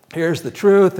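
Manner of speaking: loud proclamation of Paul; proclaiming. Retold or imitated. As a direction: announce it to the crowd. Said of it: "he says".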